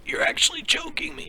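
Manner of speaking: choked, airless whisper squeezed out high; imitating being choked, barely any voice; strangled whisper. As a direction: gasp the words out as if a hand is on your throat. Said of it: "But it was more like".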